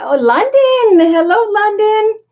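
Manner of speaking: light up as you greet them; excited greeting. Real, not acted. Host greeting a London viewer in the live chat, delighted and high.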